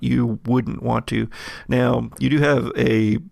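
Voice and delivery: ordinary talk, nothing marked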